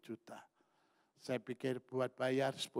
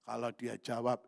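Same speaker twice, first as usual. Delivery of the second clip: ordinary talk, nothing marked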